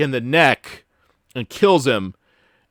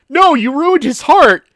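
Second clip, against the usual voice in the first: mock outcry; 11 semitones higher, 8 dB louder than usual. Acting out game character's protest.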